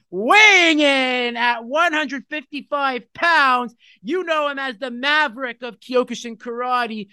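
booming ring announcer intro; grand entrance announcement. Acted. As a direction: announce him like a fight night. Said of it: "I'm gonna swear here".

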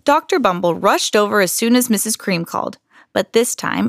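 ordinary delivery, nothing marked

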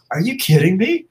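can't believe she did it; amazed. Retold or imitated. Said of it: "And I'm like".

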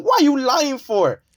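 high, slow, disbelieving whine; incredulous. Retold or imitated. Son calls out his father's lie, high and slow. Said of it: "And I was like".

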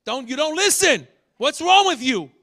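loud, confrontational telling-off; scolding rebuke. Shown as a demonstration. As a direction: tell them off loudly. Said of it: "This is a rebuke".

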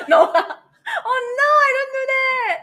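high, loud giggling denial; laughing. Real, not acted. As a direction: laugh through the words. Laughing at own joke.

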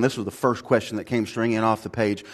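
ordinary talk

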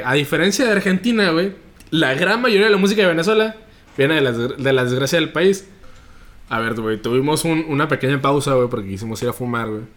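usual speaking voice

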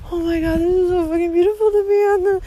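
high, fast, thrilled gush at the sunrise; giddy rush of awe, voice climbing on sunrise. Retold or imitated. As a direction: burst out in delighted wonder. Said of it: "and I'm just like".

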